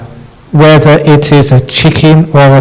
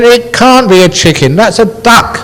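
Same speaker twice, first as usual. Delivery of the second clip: husband insisting; 8 semitones higher than usual. Acted. Husband voiced in story.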